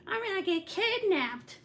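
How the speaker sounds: high whiny mock child; whiny kid voice. Mom mocking son's kidnapping fear, whiny high. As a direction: whine it like a scared little boy. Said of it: "trying to get me with that shit".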